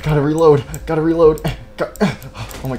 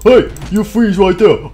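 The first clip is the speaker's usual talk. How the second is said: loud, higher, drawn-out challenge; play-fight challenge; 5 semitones higher, 7 dB louder, slower than usual. Pretend. Player confronting an enemy box, shouted challenge. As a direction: call it out slowly like a pretend standoff.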